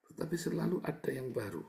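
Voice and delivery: ordinary talk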